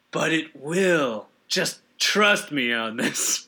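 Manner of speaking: a calm, slow assurance in a put-on voice; Miyamoto impression. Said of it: "Shiggy Miyamoto is like".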